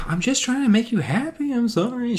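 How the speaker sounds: high, put-on apologetic voice; high-pitched mock apology; apologetic woman. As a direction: plead high, wanting to please.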